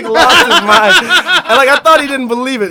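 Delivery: loud, high, laughing while talking; real laughter lifting the voice; cracking up. Real, not acted. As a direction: push the story out through laughter. Laughing at his own story.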